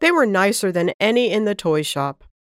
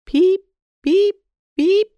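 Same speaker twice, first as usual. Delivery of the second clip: high, loud chirping bird calls; canary chirps; 12 semitones higher, 5 dB louder, slower than usual. Acted. Pet canary calling out to Roger, high chirps. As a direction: peep like a little canary. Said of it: "Roger's pet canary who was calling".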